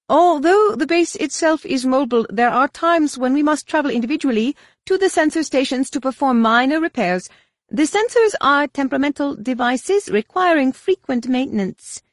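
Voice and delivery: high, cool alien administrator; alien official voice. Acted. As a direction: speak as a precise alien official.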